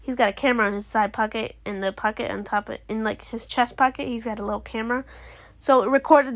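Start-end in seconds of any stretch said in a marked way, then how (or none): none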